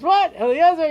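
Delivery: high, loud gasp of surprise; shocked disbelief, leaping up in pitch; astonished father. Acted. Father recognising his lost son, high and loud amazed.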